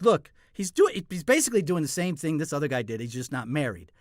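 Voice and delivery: high, loud nudging jab at the actor beside him; loud heckling whisper-shout. Retold or imitated. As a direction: call it out loud to the next actor, mocking. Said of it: "elbowing other actors".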